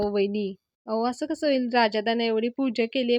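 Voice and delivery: ordinary delivery, nothing marked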